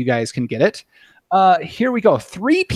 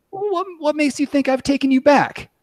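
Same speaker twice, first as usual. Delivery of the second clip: put-on much higher voice for the girlfriend; girlfriend's voice; 10 semitones higher than usual. Reading a movie scene to the panel, girlfriend played much higher. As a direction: pitch it way up to play the girlfriend. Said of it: "I may have to just for, for the giggles of it".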